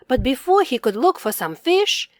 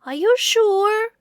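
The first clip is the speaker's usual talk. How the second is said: much higher doubtful question; tiny bear voice; 10 semitones higher than usual. Acted. Tiny bear questioning large bear, high. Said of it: "asked the tiny bear".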